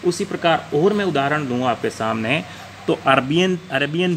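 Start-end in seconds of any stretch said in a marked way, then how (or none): none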